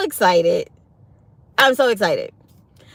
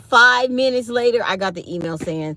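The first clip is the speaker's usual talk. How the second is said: normal talking voice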